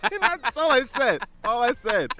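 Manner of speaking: high, loud, laughing admission. Real, not acted. Man amused at his own short proposal, laughing.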